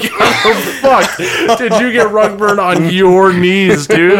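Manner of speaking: high-pitched, laughing through the words; cracking up while quoting. Retold or imitated. Laughing retelling of friend's story.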